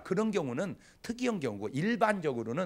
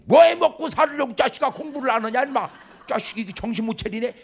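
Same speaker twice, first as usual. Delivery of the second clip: loud, raised mock yell of a hot-tempered father, much higher; angry father; 6 semitones higher, 11 dB louder than usual. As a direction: blow up at the kid like an impatient dad. (버럭 화내는 아버지 흉내)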